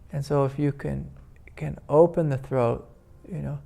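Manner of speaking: ordinary delivery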